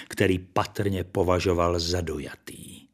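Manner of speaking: plain reading voice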